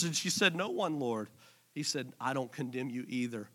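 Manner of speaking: quiet, intimate voice of Jesus; hushed retelling. Acted. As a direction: drop to a hush for the gospel scene.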